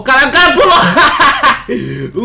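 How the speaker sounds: laughing loudly in disbelief; laughing shout. Real, not acted. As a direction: crack up while shouting it.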